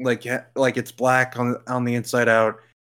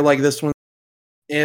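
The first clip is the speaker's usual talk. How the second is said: ordinary delivery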